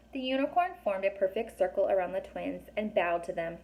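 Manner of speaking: plain reading voice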